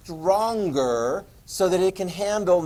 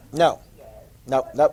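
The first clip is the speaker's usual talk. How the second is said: emphatic refusal; 4 semitones lower than usual. Real, not acted. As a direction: shut the idea down hard. Teacher rejects idea emphatically.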